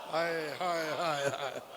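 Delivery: lament. Acted. Preacher's lament cry.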